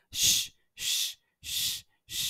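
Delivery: "শ" as separate long shushing sounds; SH sound drill. Shown as a demonstration. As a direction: repeat "শ" as a sustained shh. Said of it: "আমি আরেকবার বলে দিচ্ছি".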